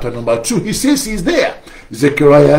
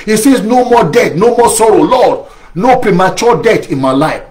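higher, forceful preaching, shouted at the end; fervent preaching; 6 semitones higher than usual. Acted. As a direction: cry out against shame and death.

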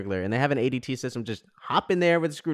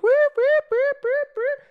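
shrill, high-pitched repeated alarm whoops; imitated alarm; 7 dB louder, slower than usual. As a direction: mimic a blaring alarm siren. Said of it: "starts fucking going like".

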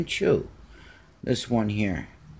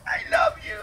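high cat voice; faster than usual. Shown as a demonstration. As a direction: squeak "I love you" way up high like the cat would say it. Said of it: "Good boy".

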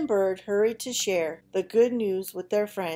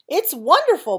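cheeping; 9 semitones higher, 9 dB louder than usual. Acted. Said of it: "Bird cheeped".

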